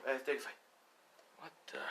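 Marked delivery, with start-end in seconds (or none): 1.4-1.8 breathless whispered shock; startled whisper. Acted. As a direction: gasp it under your breath. Startled hero sees theft.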